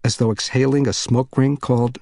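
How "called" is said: ordinary delivery, nothing marked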